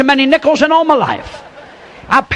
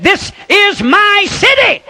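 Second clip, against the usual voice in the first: slow, higher and pointed, staking territory; mayor's claim; 8 semitones higher, slower than usual. Retold or imitated. The mayor telling the preacher whose town it is, slow and proud. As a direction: draw it out like you own the place.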